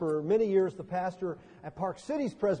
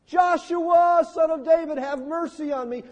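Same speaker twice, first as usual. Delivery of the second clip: "Joshua" shouted very high and loud; desperate cry; 10 semitones higher, 7 dB louder than usual. Retold or imitated. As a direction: shout for him over the crowd. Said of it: "he cries at the gates".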